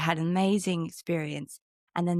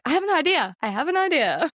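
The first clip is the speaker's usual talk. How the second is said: excited realization; 7 semitones higher, 3 dB louder than usual. Retold or imitated. Waking at five with a plan.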